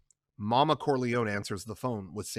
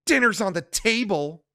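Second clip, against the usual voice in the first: higher, louder, clipped; tight anger; 9 semitones higher, 6 dB louder than usual. Acted. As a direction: hold the fury in. Connie confronts Carlo.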